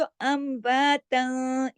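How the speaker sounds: slow, drawn-out Quran recitation; chanting the verse as a teacher's model; tajweed recitation. Shown as a demonstration. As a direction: recite it slowly with careful tajweed. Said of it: "आवाज थोड़ी नाक में चली गई".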